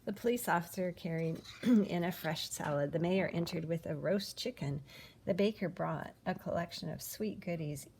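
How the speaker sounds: ordinary delivery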